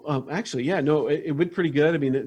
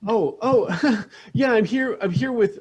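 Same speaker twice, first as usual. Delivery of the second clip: embarrassed laugh; 8 semitones higher, 3 dB louder than usual. Acted.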